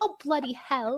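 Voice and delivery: put-on British accent. Playing up to a British accent fan, British accent. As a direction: drop the volume, keep the put-on British accent. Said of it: "My kink is British accents".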